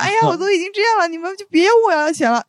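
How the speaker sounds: mock girlish whining. Girl pleads with creditors, mock whining. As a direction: ham up a helpless young girl begging. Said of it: "我说".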